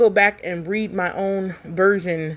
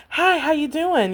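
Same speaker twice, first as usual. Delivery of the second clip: higher, hammed-up feminine greeting; mock audiobook female voice, higher; extra feminine voice; 8 semitones higher than usual. Shown as a demonstration. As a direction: pitch it up and get real extra. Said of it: "go above your larynx".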